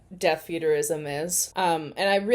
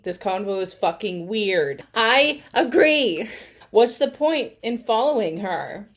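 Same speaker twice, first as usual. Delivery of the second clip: ordinary delivery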